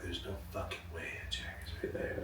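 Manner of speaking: very soft, to oneself; quiet acted private thought; hushed disbelief. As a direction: murmur it in awe under your breath. Retells private disbelief on seeing idol.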